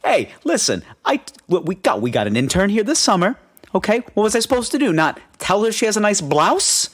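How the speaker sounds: defensive wiseguy uncle; Italian uncle voice. Retold or imitated. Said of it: "Very Italian, very just like".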